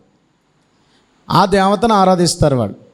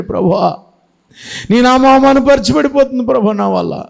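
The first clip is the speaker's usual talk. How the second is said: higher, tearful last cry to God; weeping plea; 6 semitones higher than usual. Acted. Samson weeps to God before he dies, high tearful plea. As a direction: cry it out as a broken man. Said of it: "ఏడ్చాడు".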